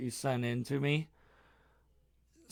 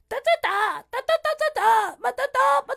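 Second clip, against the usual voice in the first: high, loud squeaky babble mimicry; mock the girl's voice in high nonsense syllables; shrill-free high mocking da-da babble; mocking her voice; 8 dB louder than usual. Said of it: "sounded like this".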